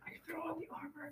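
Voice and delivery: breathy whispered offer; leaning in to whisper. Acted. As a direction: whisper it under your breath. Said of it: "We're sorry for whisper".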